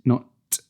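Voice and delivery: "t" with a hissing s after it; the wrong, splashy t. Shown as a demonstration. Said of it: "no splashy S at the end".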